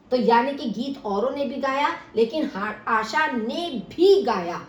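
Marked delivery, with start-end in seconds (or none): 2.9-4.6 slow and louder, आशा ने भी stressed. Shown as a demonstration. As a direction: hit आशा ने भी. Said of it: "भी शब्द से आशा के ऊपर विशेष बल". (आशा पर विशेष बल)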